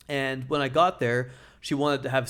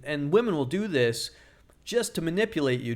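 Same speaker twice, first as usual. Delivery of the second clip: ordinary talk, nothing marked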